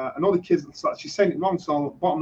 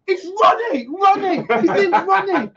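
high, loud half-laughing protest; outraged disbelief; 3 dB louder than usual. Retold or imitated. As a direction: cry out in amused outrage. Retold outrage over his name.

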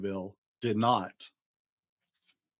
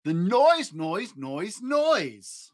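mocking noise; 6 semitones higher, much slower than usual. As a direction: mimic the din in exaggerated spikes.

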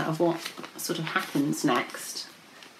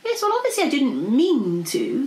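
higher, flustered dragon protest; 7 semitones higher, 3 dB louder than usual. A narrator's reading. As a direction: protest your innocence. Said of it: "protested the dragon".

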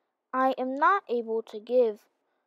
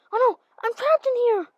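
panicked; 10 semitones higher, 5 dB louder than usual. Acted. Trapped in freezing chamber.

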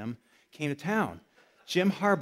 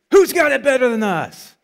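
loud, much higher rallying call; morning mantra shout; 8 semitones higher, 15 dB louder than usual. Retold or imitated. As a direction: call it out like a coach at breakfast. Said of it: "that was their morning mantra".

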